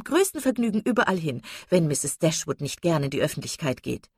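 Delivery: high, eager, insistent socialite; effusive chatterbox lady. Acted. Mrs Palmer urging the ladies to come to London, gushing. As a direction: press the invitation with gushing enthusiasm.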